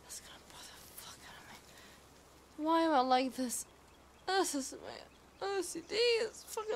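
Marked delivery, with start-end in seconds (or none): 0.0-2.6 annoyed whispered aside. Real, not acted. Streamer bothered by the layout, whispering to herself. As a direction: hiss it under your breath.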